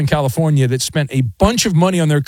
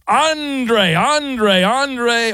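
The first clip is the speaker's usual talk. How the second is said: calling out; 6 semitones higher, much slower than usual. Acted. Host calling caller on phones.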